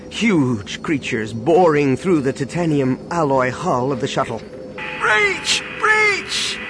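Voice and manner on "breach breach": very high-pitched panicked scream; desperate cry. A narrator's reading. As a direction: cry out as the hull gives way. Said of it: "came the desperate cry from the ship".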